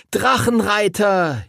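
very high, slow triumphant cheer. A narrator's reading. Friends cheer as they mount their dragons, jubilant. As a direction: shout it out together in joy. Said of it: "jubelten die anderen".

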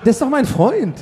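high-pitched indignant denial through laughter; amused, squeaky-high objection; laughing protest. Real, not acted. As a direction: protest your innocence, voice jumping up, half laughing. Protests teasing accusation, laughing.

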